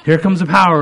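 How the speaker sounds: high, loud mock announcement; excited, showy. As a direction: hurl the words out with showy excitement. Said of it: "I was so excited".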